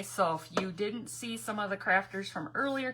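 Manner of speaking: usual speaking voice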